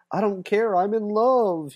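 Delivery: dreamy lovestruck. Retold or imitated. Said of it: "he's just like".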